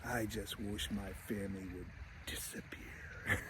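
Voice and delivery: very soft, low murmur fading into a slow whisper; dreamy wish. Retold or imitated. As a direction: make a wish under your breath, drifting to a whisper.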